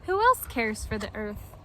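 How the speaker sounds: usual speaking voice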